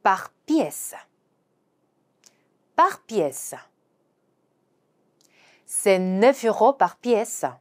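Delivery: very slow French, "neuf" stressed; slow teacher read; slow demo pronunciation. Shown as a demonstration. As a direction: model each word slowly. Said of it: "개당이라는 표현은요".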